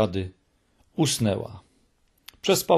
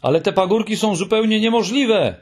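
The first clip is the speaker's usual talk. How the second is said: a high, loud desperate cry; shout; 9 semitones higher, 4 dB louder than usual. A narrator's reading. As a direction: cry it out, much higher and louder. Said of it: "krzyknął Muminek". (Muminek krzyczy: niemożliwe)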